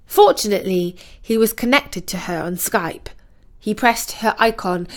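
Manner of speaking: usual speaking voice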